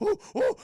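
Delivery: high, loud whoops of effort; two hammed-up straining hoots; effort grunts. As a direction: imitate heaving someone forward. Imitates thrusting boys forward.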